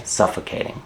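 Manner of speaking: Suffocating whispered with no voice; voiceless whisper of Suffocating. Shown as a demonstration. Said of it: "Suffocating means you can't breathe".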